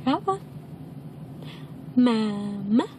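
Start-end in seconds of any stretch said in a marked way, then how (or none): 2.0-2.9 lower, drawn-out Mama lifting at the end; coaxing model word. Shown as a demonstration. Parent modelling Mama for baby, slow coaxing. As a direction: stretch it out for a baby to copy.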